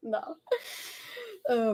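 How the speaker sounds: normal talking voice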